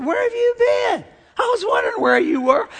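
high, bright-pitched imitation of his wife; cheerful wife. Husband imitating his cheerful wife asking where he's been, high and sunny. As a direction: chirp it like a happy wife greeting him.

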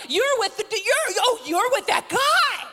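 hammed-up finger-pointing shout; excited accusation. Acts bystanders excitedly accusing Peter.

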